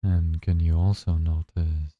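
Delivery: slow, calm meditation guide voice; guided meditation. Acted. Guide leading listeners through their first breathing meditation, slow and calm. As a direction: ease them into the breath, unhurried and gentle.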